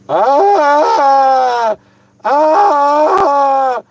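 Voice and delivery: high sharp gasps; yelping from cold water. Real, not acted. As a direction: gasp high as the water runs down. Dumping water on his head.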